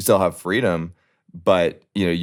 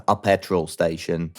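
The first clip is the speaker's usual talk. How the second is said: bad British accent; 3 semitones lower, slower than usual. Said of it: "Dude, that was a terrible accent".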